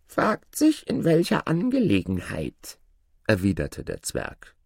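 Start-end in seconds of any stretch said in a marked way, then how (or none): 0.1-2.8 very high little dwarf voice; guarded dwarf spirit. Acted. Dwarf asks Aladin what help he needs, high guarded voice. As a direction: answer cagily in a squeaky-high pitch.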